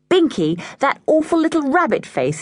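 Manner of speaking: high, appalled cry; horrified. A narrator's reading. George appalled at the visitor's name, horrified high cry. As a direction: recoil at the name. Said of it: "said George in horror".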